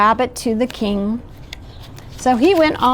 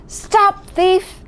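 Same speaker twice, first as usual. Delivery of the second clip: mock yell; 9 semitones higher than usual. A narrator's reading. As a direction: cry out high while holding back the volume. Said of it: "at the very top of my voice".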